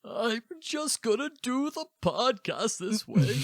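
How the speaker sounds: put-on very high, slow, loud old man; hammed-up old codger, drawn out way up high. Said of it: "use the old man voice".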